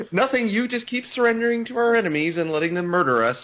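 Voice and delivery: high, laughing accusation; laughing retort. Pretend. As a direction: laugh through a sarcastic comeback. Playing Matthias answering abbot.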